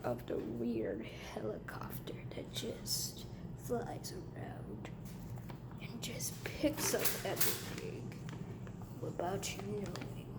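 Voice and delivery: unvoiced spooky whisper. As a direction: whisper it like a secret.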